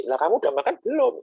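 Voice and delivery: a confused outsider fires the question back; surprised retort. Retold or imitated. Outsider confused by Makassar politeness, surprised retort. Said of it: "kok malah nanya ke saya".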